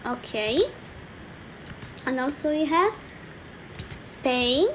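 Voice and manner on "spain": usual speaking voice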